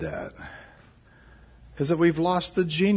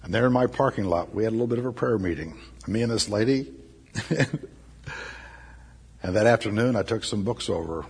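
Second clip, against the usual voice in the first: ordinary delivery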